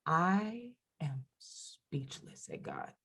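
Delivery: slow, hushed, breathless amazement; awed whisper. Retold or imitated. Best friend amazed by what God did, awed whisper. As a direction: whisper it slowly, lost for words.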